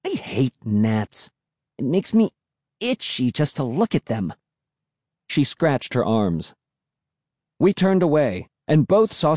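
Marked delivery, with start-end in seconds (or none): 0.1-4.3 muttered grumble. A narrator's reading. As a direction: grumble with a touch of distaste. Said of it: "Emily muttered".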